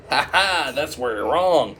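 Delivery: gloating villain. Retold or imitated. Curser telling the guesser he is wrong, gloating. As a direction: crow over a wrong guess, hammed up.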